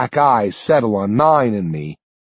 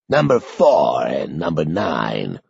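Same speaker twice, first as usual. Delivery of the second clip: put-on low, slow, frail old man; old Sanderson; 9 semitones lower, slower than usual. A narrator's reading. Old man greeting two boys, low and slow. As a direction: croak it out like an aged official. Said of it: "Sanderson says".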